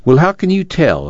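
high-pitched, challenging question; demanding. A narrator's reading. Uncle challenges Ma's faith in going on, demanding. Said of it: "Uncle John demanded".

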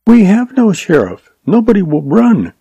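put-on high, earnest young schoolteacher, a touch of anger; lightly voiced girl blaming the outlaw; young woman's voice. A narrator's reading.

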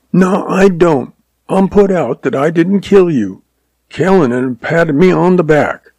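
put-on high, sulky boy's voice; defiant young boy. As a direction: talk back like a put-out kid.

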